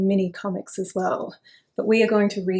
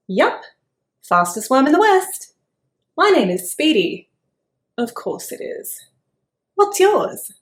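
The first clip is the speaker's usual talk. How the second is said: perky worm; 5 semitones higher, 7 dB louder than usual. Acted. Worm introduces himself.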